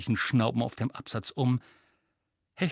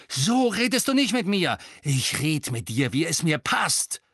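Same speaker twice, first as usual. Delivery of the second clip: angry exchange; 5 semitones higher, 4 dB louder than usual. A narrator's reading. Acted quarrel between roommates.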